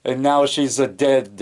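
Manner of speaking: slow, mournful character voice; imitating Cajun Joe mourning, slowly; Cajun Joe's voice. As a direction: drag out a sorry lament for Bertha. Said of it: "that's how he talks".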